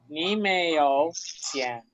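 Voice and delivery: Ni meyo cien said very slowly, word by word; slow drill. Shown as a demonstration. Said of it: "drills the phrase". (despacio, separando cada palabra)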